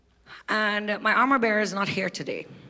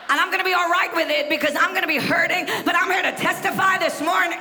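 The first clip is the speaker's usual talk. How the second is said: full-force preaching; 7 semitones higher than usual. Acted. Preacher lifting the congregation, shouting testimony.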